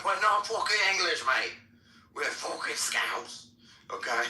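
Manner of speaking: high mock Scouse retort; insistent Liverpool accent, put on; indignant Scouser. Imitating Scousers correcting an outsider, indignant Scouse. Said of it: "and they were like".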